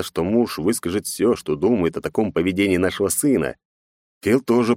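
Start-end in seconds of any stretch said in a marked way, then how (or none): none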